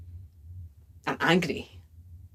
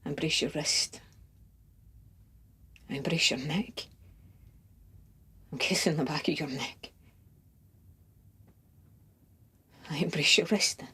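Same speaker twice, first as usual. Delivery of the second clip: tender intimacy; 7 dB quieter than usual. Acted. Lover imagining dressing and embracing the beloved, softly tender. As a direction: say it hushed, lingering between each touch.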